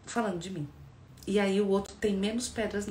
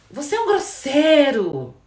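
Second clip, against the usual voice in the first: loud, slow, higher accusing yell; accusing shout; 6 semitones higher, 13 dB louder, slower than usual. Shown as a demonstration. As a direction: throw the insult at your partner. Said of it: "Me incomodou um pouco o jeito".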